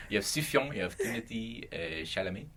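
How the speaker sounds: mock French accent; lightly put-on French accent. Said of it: "You have the worst French accent ever".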